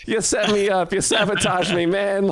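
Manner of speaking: high-pitched mock protest; hammed-up cry of betrayal at mom; mock outrage. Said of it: "And I was just like".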